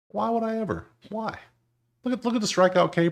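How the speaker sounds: high, incredulous whine; incredulous squeal. Real, not acted. Bettor rejecting a pick on awful percentages, incredulous squeal. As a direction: pitch it way up in disbelief.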